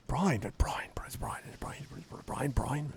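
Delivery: whispered murmur of a crowd; voiceless crowd whisper of Brian's name. Acted. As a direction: breathe the name like rumours spreading.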